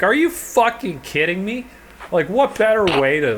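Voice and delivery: high, loud mock outrage; incredulous rebuke. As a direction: tear into a friend's dumb idea. Retold rebuke of friend's dumb idea.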